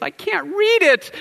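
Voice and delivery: put-on very high child voice; squeaky little boy. Four-year-old son voiced.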